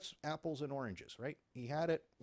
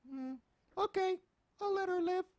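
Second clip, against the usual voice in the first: high mock robber voice; 9 dB louder than usual.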